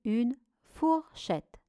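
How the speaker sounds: "fourchette" split between four and chette; syllable by syllable. Shown as a demonstration. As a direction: dictate "fourchette" in two detached syllables. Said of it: "Première syllabe". (« fourchette » découpé syllabe par syllabe)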